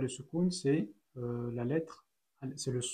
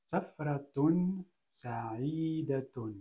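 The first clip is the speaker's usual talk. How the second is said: safratun sa'idatun read very slowly; slow careful reading; much slower than usual. Shown as a demonstration. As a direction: read the phrase out slowly for learners. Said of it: "Donc si je lis".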